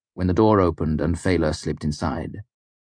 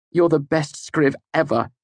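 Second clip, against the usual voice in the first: raised pitch, emphatic compliment; obliging praise; 10 semitones higher than usual. A narrator's reading. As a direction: give the demanded praise, falling at the end. Narrator's character obliges her demand.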